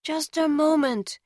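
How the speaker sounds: high voice muffled behind a door; as if behind a closed door. A narrator's reading. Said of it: "I heard a muffled voice say".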